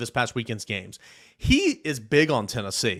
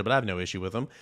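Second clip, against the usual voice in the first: usual speaking voice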